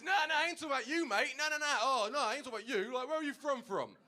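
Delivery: fast, high, loud flustered denial; scrambling to take it back, fast and high; panicked backpedalling driver. Retold or imitated.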